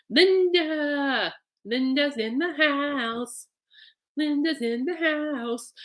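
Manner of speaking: high, slow, drawn-out announcer welcome; stretched, sing-song introduction of Linda; announcer call-out. Retold or imitated. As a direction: bellow it like an arena announcer. Calling out her own arrival.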